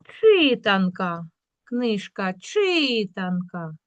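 "читанка" with the first И stretched; stress on И. Shown as a demonstration. Teacher giving the correct check word, stressed И. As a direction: lean slowly on the first syllable of "читанка". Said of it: "Наголос падає на".

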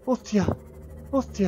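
high startled exclamation; startled cry. Real, not acted. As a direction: blurt it in shock. Startled by the Reaper.

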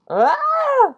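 sudden shrill scream; high scream. Real, not acted. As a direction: scream as you fall. Pig ride scare.

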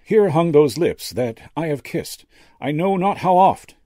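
high, loud, measured Shakespeare; actor's quotation. A narrator's reading. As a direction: declaim to the skull with stage weight. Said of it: "he quoted again".

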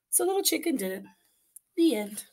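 usual speaking voice

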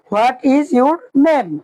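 slow English, each word drawn out; very slow model. Shown as a demonstration. Teacher slowly models the English question for beginners. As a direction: spell it out slowly for the class. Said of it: "কি?".

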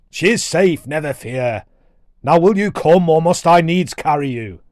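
big brusque storybook dwarf voice; impatient dwarf warrior. Acted. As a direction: order the boy along impatiently.

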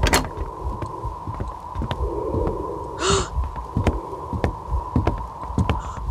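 long high frightened gasps; scared panting in hiding; panicked breathing. Real, not acted. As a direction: breathe in terror while hiding. Hiding from monster.

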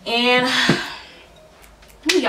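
breathless sigh, winded; out of breath. Real, not acted. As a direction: speak while catching your breath. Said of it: "I'm so out of breath".

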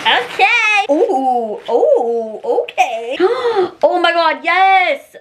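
drawn-out delighted oohs, a gasp; excited unboxing ooh. Real, not acted. Vlogger gasping over a new dress, delighted. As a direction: savor the reveal with a gasp.

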